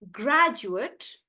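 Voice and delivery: "graduate" stressed on GRAD; "graduate" with short final syllable; noun pronunciation. Shown as a demonstration. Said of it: "I will pronounce them as".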